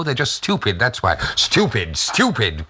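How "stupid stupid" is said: exasperated cry. A narrator's reading. Mina fed up with endless questions, exasperated cry. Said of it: "cried Mina".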